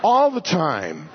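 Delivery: slow, emphatic call to the congregation. Real, not acted. Preacher leading the congregation in a familiar response, calling out. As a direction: lead the call and response, drawing out each word.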